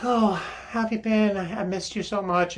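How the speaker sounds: put-on girlfriend voice. Girlfriend voiced in retold film scene.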